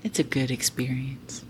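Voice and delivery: a hushed, breathy whisper. Real, not acted. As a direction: whisper it like a shared secret. Playful hushed echo.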